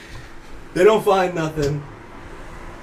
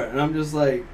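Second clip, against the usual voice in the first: ordinary talk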